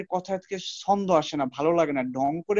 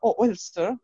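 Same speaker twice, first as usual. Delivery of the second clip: a bit of flair; 2 semitones higher than usual. Shown as a demonstration. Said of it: "একটু ঢং করে কিভাবে বলতে পারি".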